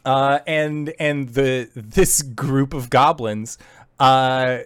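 ordinary delivery

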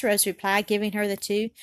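usual speaking voice

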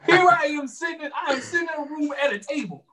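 high, whiny complaint; mock whining. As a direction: complain like you've been wronged. Mock complaint about unfair treatment.

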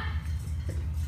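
sudden coughing fit; choking cough. Real, not acted. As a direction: cough like something went down wrong. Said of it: "Ich hab mich grad verschluckt an der Breze".

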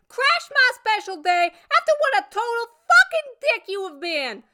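high-pitched furious tirade; shrill, relentless outraged rant, piling up grievances; screamed. A narrator's reading. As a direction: scream at an ex who crashed the party. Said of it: "and screamed at him".

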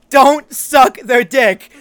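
slow, loud shouted rule; emphatic shout. Real, not acted. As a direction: lay down the law loudly. Stating rule number one emphatically.

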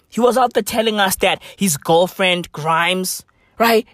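ordinary talk, nothing marked

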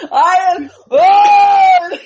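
high, loud laughing mimic of a flexing shout; mock sleepwalker flex yell. Imitating her sleepwalking husband flexing, shouting.

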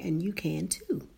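ordinary talk, nothing marked

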